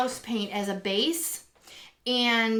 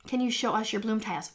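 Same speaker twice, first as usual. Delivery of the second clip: ordinary delivery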